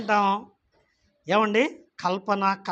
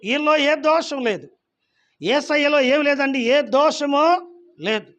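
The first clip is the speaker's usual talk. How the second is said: higher, louder, slowing emphatic verdict; Pilate's declaration; 6 semitones higher, 5 dB louder than usual. Acted. Pilate declaring Jesus innocent, loud and emphatic. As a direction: declare it firmly, slowing at the end. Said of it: "పిలాతు ఏమన్నాడు?".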